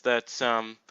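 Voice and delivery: ordinary talk, nothing marked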